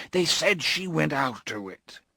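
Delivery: mild weeping confession; tearful confession. Acted. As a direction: confess through tears. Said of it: "he confessed".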